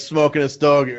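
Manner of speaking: ordinary talk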